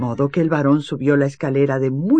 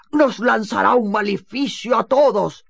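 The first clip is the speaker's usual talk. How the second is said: higher, fearful cry; alarmed exclamation; 8 semitones higher than usual. Acted. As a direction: warn everyone in panic. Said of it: "exclamó el varón". (exclamación asustada)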